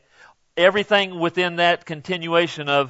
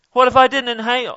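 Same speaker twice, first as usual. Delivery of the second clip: higher, faster mock of a dithering questioner; 7 semitones higher, faster than usual. Preacher mocking people asking obvious questions, raised pitch. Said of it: "these people coming around".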